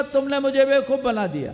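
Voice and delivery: high, fast accusation; acted indignation. Tricked suitor comes back to the girl, indignant.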